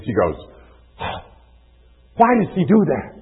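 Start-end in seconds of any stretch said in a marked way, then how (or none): 2.2-3.1 higher and louder, baffled complaint; tired mother asking why her son ignores her; exasperated mom. Retold or imitated. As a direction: throw up your hands at the kid. Exasperated mom after yelling.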